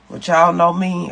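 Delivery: ordinary talk, nothing marked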